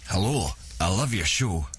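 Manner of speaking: low, put-on American drawl of a fan; a clumsy attempt at an American accent; imitating the actor's American voice, badly. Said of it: "how many times have I told you not to attempt accents".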